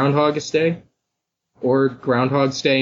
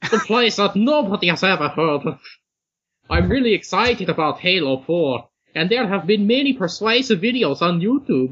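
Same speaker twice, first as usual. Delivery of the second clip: lilting, higher mock Irish accent; 6 semitones higher than usual. Host reading the New Zealander's submission, now as Irish accent. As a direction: read it in a wobbly put-on Irish accent. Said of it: "he's, he's Irish".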